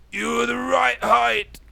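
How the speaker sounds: high, slow, monotone put-down; drawn-out, deadpan old-teacher voice; addled old teacher. Acted. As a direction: drone it bluntly on a high note. Old teacher suddenly answers pupil.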